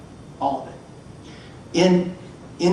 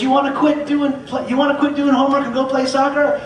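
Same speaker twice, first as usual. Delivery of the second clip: playful teasing; 10 semitones higher, 5 dB louder than usual. Retold or imitated. Loveless teasing the boys.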